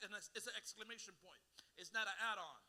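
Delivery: ordinary talk, nothing marked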